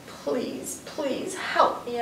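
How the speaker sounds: soft, slow begging; pleading. Acted. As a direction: plead down the phone. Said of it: "I said".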